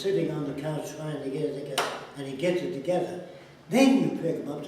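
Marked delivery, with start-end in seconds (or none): none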